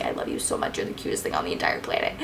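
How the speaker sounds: soft, voiceless loving gush; retold adoration. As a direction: whisper it adoringly, quietly. Retold adoring words to her daughter.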